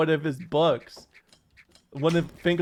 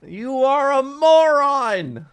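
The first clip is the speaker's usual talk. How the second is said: high, slow, loud indignant yelling; drawn-out furious professor shout; Finkelstein voice; 9 semitones higher, 4 dB louder, slower than usual. Pretend. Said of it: "Can you do a normal Finkelstein voice?".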